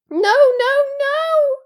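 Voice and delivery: very high, very loud elf cry; panicked squeak. A narrator's reading. House-elf refuses to let Harry go, squeaking. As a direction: squeal it in alarm. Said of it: "squeaked Dobby".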